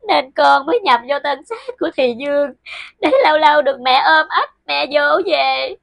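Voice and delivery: put-on weeping ghost child. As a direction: sob out the longing for her mother. Ghost daughter weeps for mother's embrace.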